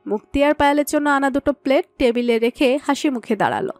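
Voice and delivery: ordinary talk, nothing marked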